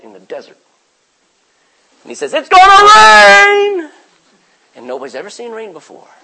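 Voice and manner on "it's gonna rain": drawn-out, slow shout, high and loud; Noah's warning shout. Retold or imitated. Noah calling from his boat, slow high shout. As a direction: bellow it like a prophet nobody believes.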